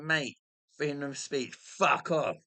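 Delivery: retold shout back at the police; loud, cocky brush-off; defiant retort. Retells shouting back at approaching police.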